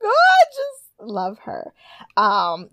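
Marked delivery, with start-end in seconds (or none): 0.0-0.8 high gushing. Real, not acted. Gushing over the photo.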